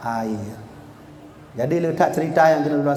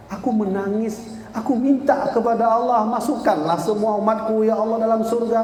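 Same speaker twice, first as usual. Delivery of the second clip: normal talking voice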